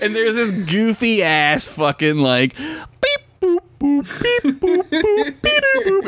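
high mock computer beeps; beeping noises. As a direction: beep and boop way up high. Imitating TV sound effects.

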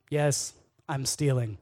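slow, loud owning up; retold blunt confession. Kid caught by the principal, blunt confession.

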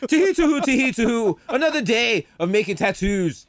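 high, sing-song cartoon Irish; mock Irish accent. Host spoofs Irish tattoo shop workers, mock Irish accent. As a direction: chirp a silly Irish jingle.

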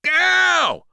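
high, loud yelp of pain; pained scream. A narrator's reading. Boy grabbed by the arm, screaming. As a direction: cry out at full pitch. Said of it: "he screamed".

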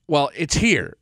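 usual speaking voice

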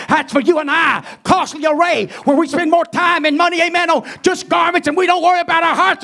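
shouting, high fiery preaching; doomsday street preacher. Real, not acted. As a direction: thunder out a warning of judgment.

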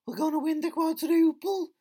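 high, loud mock-triumphant fan voice; mocking Liverpool fans. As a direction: ham up a gloating fan's cheer. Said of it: "everybody thought Liverpool were gonna win".

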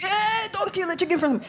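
high-pitched mock protest, no real volume; mock shout. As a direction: imitate your own dramatic panicked cry. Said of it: "I'm start shouting".

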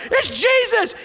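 high, loud cry of recognition; John shouting from the boat; excited shout. Retold or imitated. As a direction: call it out the moment you see him. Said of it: "John sees it first and goes".

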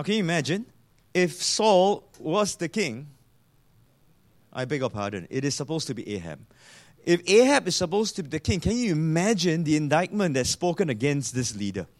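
usual speaking voice

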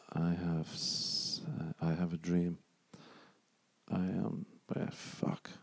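quiet, half-whispered fumbling for the Irish; almost under his breath, trying to remember; muttering to himself. Real, not acted. Trying to recall an Irish phrase, nearly whispering.

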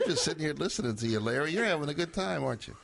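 giggling, high teasing; laughing tease. Real, not acted. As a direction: tease him through laughter. Teasing the tipsy guest.

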